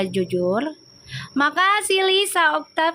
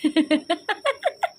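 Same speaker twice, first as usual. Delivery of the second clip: laughing; 4 semitones higher than usual. Real, not acted. Still laughing at own joke.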